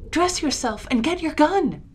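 high, loud urgent order; urgent cry. A narrator's reading. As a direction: call out in alarm to rouse him. Child urgently rousing sleeping father.

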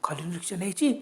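usual speaking voice

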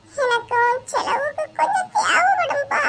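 high, whimpering plea; tearful. Acted. Son nervously asking father for money, tearful. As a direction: plead as if about to cry. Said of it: "நீ இப்ப எதுக்கு அழுற".

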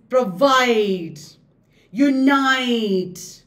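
provide, unite with second syllables stretched long; iambic stress. Shown as a demonstration. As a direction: lean slowly on proVIDE, uNITE.

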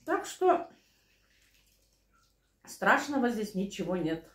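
ordinary delivery

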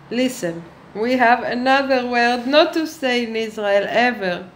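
usual speaking voice

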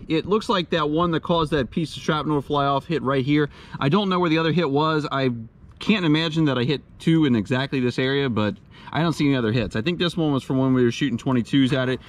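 ordinary delivery

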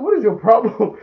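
loud, high mock exasperation; exasperated outburst. Narrator scolding Orc for his fake injury, mock-exasperated. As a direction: blurt it out in playful outrage.

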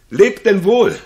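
ringing call of goodbye; loud farewell. Acted. Camillo calling goodbye to the departing prince, loud.

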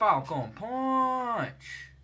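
slow drawn-out Falcon Punch battle cry; Falcon Punch yell. Pretend. Launching his rider with a drawn-out Falcon Punch battle cry. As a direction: stretch every syllable like a fighting-game hero. Repeats the catchphrase.

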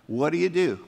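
ordinary talk

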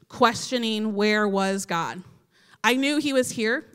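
ordinary talk, nothing marked

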